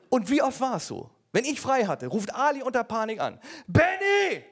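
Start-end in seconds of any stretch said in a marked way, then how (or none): 3.7-4.4 high, loud panicked cry of a name; panicked call. Retold or imitated. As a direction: yell it like a frightened friend. Ali calls in panic.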